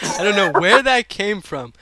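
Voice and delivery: laughing, loud disbelief; amused bafflement. Real, not acted. Host thrown by guest's pillow joke, laughing bafflement. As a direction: laugh your way into the words, thrown off.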